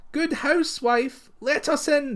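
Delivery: high, slow plea from the little folk at the door; fairies crying out. Acted. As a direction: call up high and drawn out, begging to be let in. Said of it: "they cried".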